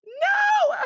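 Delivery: high loud protesting cry through tears; laugh-cry refusal; tearful no. Real, not acted. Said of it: "I'm crying happy tears".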